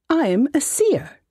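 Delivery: high, put-on voice of a fussy old halfling mage; high-voiced wizard. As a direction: whine it like a pompous little wizard. Wizard boasts.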